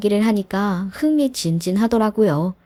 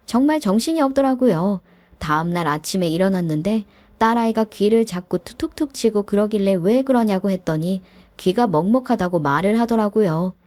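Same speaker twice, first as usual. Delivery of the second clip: ordinary delivery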